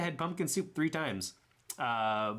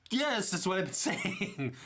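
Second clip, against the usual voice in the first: 1 laughing exasperation; 7 semitones higher, slower than usual. Retold or imitated.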